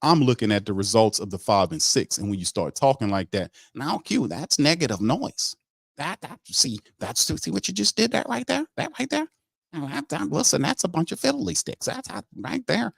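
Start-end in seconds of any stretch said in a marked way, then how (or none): 3.7-12.9 lampoon the general manager in a high, prissy voice; high mock scolding of negative noise; mocking the brass. Mocking the team brass's talk.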